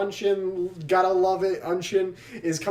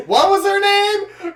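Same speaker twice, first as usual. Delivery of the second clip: mock confused; 13 semitones higher, 8 dB louder, slower than usual. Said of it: "We're just like".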